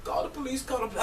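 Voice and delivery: high, soft, mock tearful plea; imitated near-crying. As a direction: imitate her voice giving out near tears. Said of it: "she sounded like she was about to start crying".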